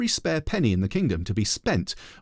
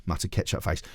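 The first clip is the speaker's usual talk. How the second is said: ordinary delivery, nothing marked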